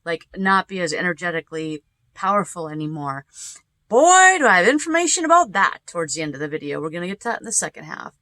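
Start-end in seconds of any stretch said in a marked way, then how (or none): 3.9-5.8 excited exclamation. Real, not acted. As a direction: burst out with delight.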